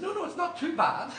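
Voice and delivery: loud, squeaky-high mock politeness; comic high, embarrassed denial; high-pitched backtracking. Husband backtracking to the artist.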